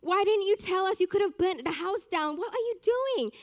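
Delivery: put-on mother scolding her child over the burnt pillow; angry mum. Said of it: "And she got so angry".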